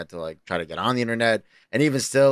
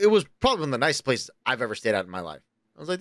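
ordinary talk, nothing marked

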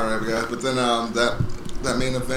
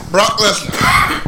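mock solemn address; 6 semitones higher, much slower than usual.

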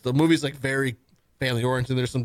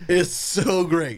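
higher, slow, drawn-out praise; gushing enthusiasm; 4 semitones higher, much slower than usual. Real, not acted. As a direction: stretch the praise out with relish. Gushes about favorite character.

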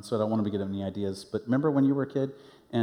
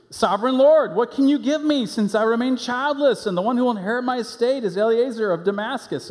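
higher, louder pleading complaint; complaining loudly; 10 semitones higher, 5 dB louder than usual. Acted. Abram complaining to God about having no children, loud pleading. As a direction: cry out to the Lord in exasperation.

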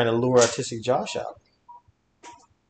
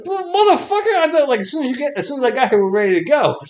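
high, loud mock protest; exasperated retold complaint; 12 semitones higher, 6 dB louder than usual. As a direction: complain loudly, pitch starting way up. Acted complaint to a stalling friend.

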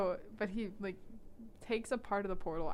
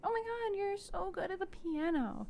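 put-on much higher, swooning girl voice; gushing Clary; 11 semitones higher than usual. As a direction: gush over him in a high breathy-free squeal. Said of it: "Clary's like".

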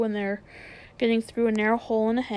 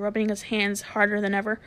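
ordinary delivery

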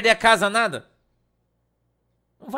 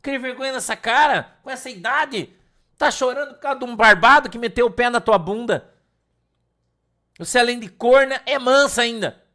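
scolding rant; 5 semitones higher, 7 dB louder than usual. Retold or imitated.

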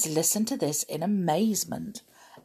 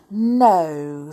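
ordinary delivery, nothing marked

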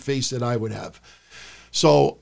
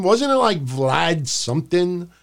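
ordinary talk

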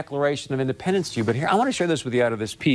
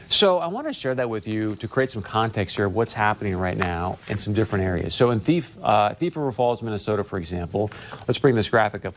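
ordinary delivery

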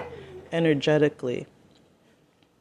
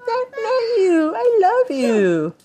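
high whiny coo; squeaky pet voice. Real, not acted. Cooing, crying voice at pet.

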